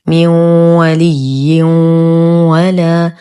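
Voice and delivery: slow من ولي ولا, nasal hum merging into each و; incomplete idgham. Shown as a demonstration. Teacher shows noon and tanween before waw, nasal merging. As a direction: melt ن and tanween into و with a held hum. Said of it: "الحكم إدغام ناقص آخر".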